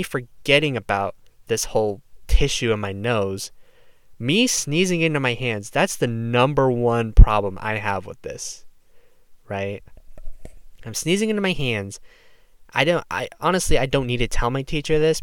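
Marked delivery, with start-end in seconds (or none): none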